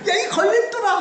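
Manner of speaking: mock angry yell. Jesus yelling at Peter, laughing mock anger. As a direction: hurl the insult with a laugh. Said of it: "막 이러면서".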